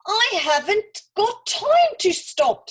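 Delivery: high, loud, slow irritated snap; snapped. A narrator's reading. Olga snapping at the annoying mouse, loud and cross. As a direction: bite the words off impatiently. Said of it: "snapped Olga".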